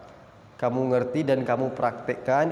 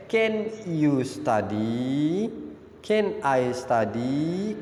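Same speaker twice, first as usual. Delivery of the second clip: slow model sentence; much slower than usual. Shown as a demonstration.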